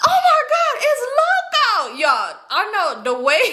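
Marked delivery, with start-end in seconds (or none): 0.0-2.0 very high, drawn-out squeal of delight; overjoyed recognition. Retold or imitated. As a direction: squeal like greeting a long-lost favorite. Retold joy on recognising Loco.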